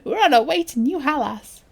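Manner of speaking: put-on lively, childlike storytelling voice; animated kid character reading aloud; child reading a note. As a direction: tell it like an excited little creature.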